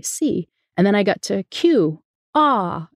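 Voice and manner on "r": letter R said as "ah"; R-less letter R. Acted. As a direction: say "ah" for R, the r sound missing entirely. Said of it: "Basically, I couldn't say R".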